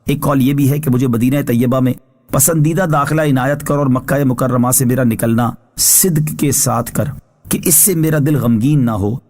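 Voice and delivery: normal talking voice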